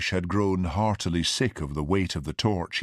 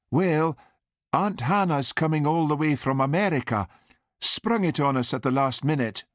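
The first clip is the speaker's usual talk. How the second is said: higher-pitched mother's voice; mother explaining; 8 semitones higher than usual. A narrator's reading. Mother telling son of aunt's visit, high voice.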